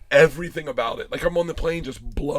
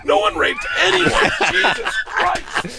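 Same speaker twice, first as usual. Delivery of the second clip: high-pitched, laughing yell; hammed-up dad at wit's end; exasperated shout; 9 dB louder, slower than usual. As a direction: bellow it as the fed-up last word. Acts out final exasperated shout.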